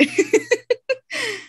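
high-pitched burst of laughter. Real, not acted. Friend laughing at her own "glad you quit" line, high laugh.

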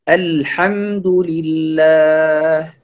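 الحمد لله drawn out slowly, "al" thin and light; correct light articulation. Shown as a demonstration. Teacher models the verse opening, slow careful recitation of الحمد لله. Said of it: "مرققًا".

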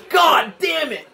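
real gamer shout; angry outburst. Real, not acted. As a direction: blurt it out in real anger. Fails again in game.

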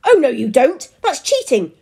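high, loud protest; outraged cry. A narrator's reading. Husband lunges for the bottle, crying out. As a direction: cry out to stop a cheat. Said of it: "cried Mister Prune".

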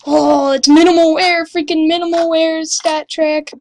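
loud burst of delight; excited discovery. Real, not acted. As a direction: exclaim it like the prize just got better. Discovers item's good condition.

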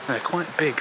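usual speaking voice

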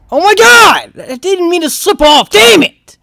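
high, loud cry of frustration; furious, dismayed outburst; exasperated yell. Real, not acted. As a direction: shout in anger at your own slip. Slips off in game.

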